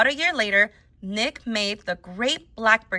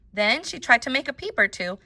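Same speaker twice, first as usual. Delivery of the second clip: usual speaking voice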